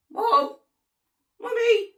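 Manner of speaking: put-on high, loud, childlike call; scared calling for mum. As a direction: cry out for your mum, mock-frightened.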